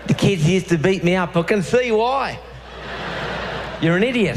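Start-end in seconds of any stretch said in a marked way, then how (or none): 1.4-4.4 blunt psychologist. Retold or imitated. Voicing the psychologist's verdict.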